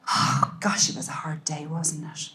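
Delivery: soft, hushed bedtime comfort; tender whisper. Shown as a demonstration. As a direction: whisper it like you're snuggled beside them. Tucking child into bed, tender talk.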